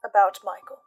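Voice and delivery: ordinary delivery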